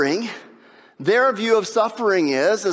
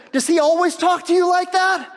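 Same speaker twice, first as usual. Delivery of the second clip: officer surprised; 9 semitones higher than usual. Retold or imitated. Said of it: "the officer looks at his wife and says".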